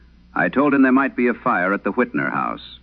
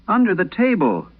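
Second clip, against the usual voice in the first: put-on much higher, scared little boy's voice; frightened crying boy; 14 semitones higher than usual. Trapped boy begging the night operator for help, high frightened child voice. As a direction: plead like a terrified small child, pitched well up. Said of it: "The boy was already crying".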